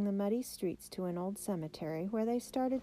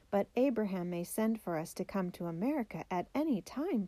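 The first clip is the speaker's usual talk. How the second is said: usual speaking voice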